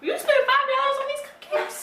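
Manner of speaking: high incredulous shriek; shocked. Real, not acted. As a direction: squeal it in disbelief. Shocked outburst during challenge.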